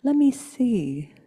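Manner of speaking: ordinary talk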